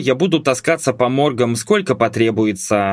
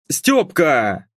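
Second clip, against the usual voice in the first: booming call; 3 semitones higher than usual. A narrator's reading. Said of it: "Раздался зычный мамин бас".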